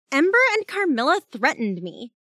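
high, loud tattling; aggrieved complaint. A narrator's reading. Jazz telling Alpha about the threats, aggrieved. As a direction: complain indignantly, pitched well up.

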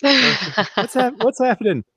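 high, bewildered voice of the wife; confused wife voice. Retold or imitated. A confused wife asking her husband what is happening, high and baffled. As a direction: squeak it out like a baffled spouse.

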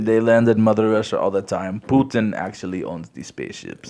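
mock Russian accent, hammed-up on request; deliberately bad Russian accent; awful Russian accent. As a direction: play a defiant Russian with a bad put-on accent. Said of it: "do an awful Russian accent".

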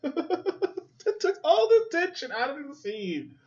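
laughing through the words, high and slow; can't stop laughing at the cameo. Real, not acted. To viewers after a cameo ruins the scene, laughing.

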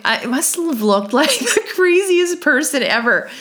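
high-pitched, laughing incredulity; real amused squeal through the words; laughing while talking. Real, not acted. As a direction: let the laugh push your voice way up. Laughs at how crazy she looked.

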